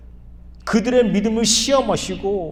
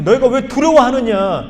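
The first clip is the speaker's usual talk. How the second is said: loud, higher stern rebuke; Jesus rebuking; 6 semitones higher, 7 dB louder than usual. Acted. Jesus scolding the frightened disciples, loud and stern. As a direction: call them out for their fear. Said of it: "제자들을 꾸짖었어요".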